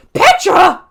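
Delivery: loud high cry of the name; anguished call. Real, not acted. Gamer crying out as Petra is killed, loud and high. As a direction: cry out her name in dismay. Gamer calls out to dying NPC.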